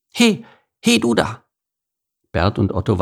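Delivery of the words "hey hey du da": high-pitched interrupting shout; calling out. Acted. Squirrel interrupts the beaver, calling out. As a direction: call urgently to get attention. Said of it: "von einem lauten Ruf unterbrochen".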